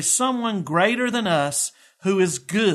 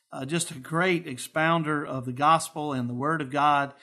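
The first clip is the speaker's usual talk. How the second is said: normal talking voice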